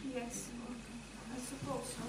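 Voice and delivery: very soft, low murmur; pensive agreement. Acted. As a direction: agree faintly, almost to yourself. Pensive, half-hearted agreement.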